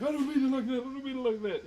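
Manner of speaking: very high, loud backpedalling; flustered mock protest. Streamer reenacts spoof villain defending himself, mock-flustered. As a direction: squeal a defensive denial, said twice. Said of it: "He's like".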